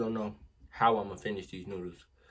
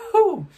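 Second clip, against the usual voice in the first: loud pained whew; 15 dB louder than usual. Real, not acted. Reacting to spicy noodles.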